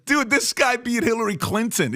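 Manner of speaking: high excited quote of Bill Burr; incredulous comedian quote. Retold or imitated. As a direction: pitch it way up in disbelief. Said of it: "He says".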